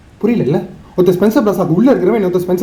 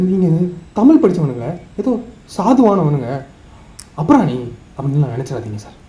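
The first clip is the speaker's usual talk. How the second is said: ordinary delivery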